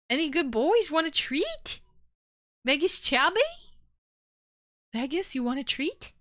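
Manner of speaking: high sing-song doggy voice; talking to a dog. Real, not acted. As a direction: coax the puppy with high questions. Asking her dog about treats.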